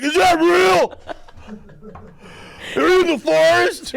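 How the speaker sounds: high, loud, hammed-up outburst; mock freaked-out yell. Podcaster mimicking the co-host's reaction to a joke, high mock yelling.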